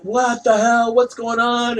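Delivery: high-pitched mock panic; retold freak-out. Running from the birds in the story, high freaked-out cry. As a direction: wail it high, imitating your panicked self. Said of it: "I'm freaking out".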